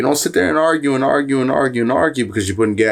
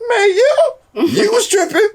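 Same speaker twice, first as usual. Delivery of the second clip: very high, laughing, near tears; laughing at his dad, very high and breaking; laughing hard; 3 dB louder, slower than usual. Real, not acted. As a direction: crack up through the words. Laughing at dad's old ways.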